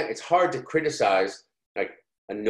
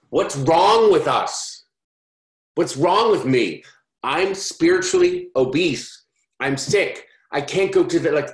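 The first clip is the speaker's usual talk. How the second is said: louder, higher, slow self-scolding; slow, loud cry of mock despair at himself; hammed-up frustration at his own restlessness; exasperated outburst; 6 semitones higher, 6 dB louder than usual. Exasperated at failing to sit still.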